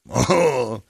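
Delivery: high, slow, loud cartoon voice; goofy alter ego. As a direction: ham it up as a fake superstar. Playing Miguel character.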